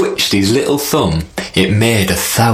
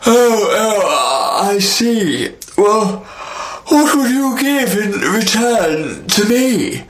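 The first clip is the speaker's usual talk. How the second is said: sky king; 11 semitones higher, slower than usual. Acted.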